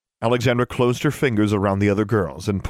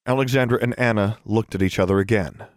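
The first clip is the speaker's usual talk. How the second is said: usual speaking voice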